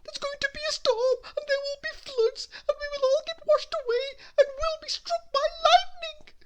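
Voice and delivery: Mister Jelly fearing floods and lightning, panicky. Acted. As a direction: babble your fears in a rising panic. Mister Jelly panicking about storm.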